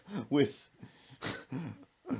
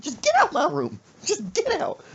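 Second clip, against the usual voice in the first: high, loud screamed order; mock mad mama; 8 dB louder than usual. Mother throwing son out of her room, high mock rage. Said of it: "And she's mad".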